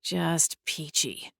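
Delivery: soft, slow sarcastic mutter. Acted. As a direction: grumble it under breath. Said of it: "I muttered".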